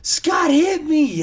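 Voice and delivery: high, loud and slow whiny accusation; sister's fake accusation. Sister tells dad her brother hit her, high whiny wail. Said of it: "And she goes".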